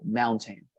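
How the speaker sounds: "Mountain" with the stress on "Moun"; stress on first syllable. Shown as a demonstration. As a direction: hit "Moun" in "Mountain" and let "tain" fall away. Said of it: "The accent would be in the first syllable".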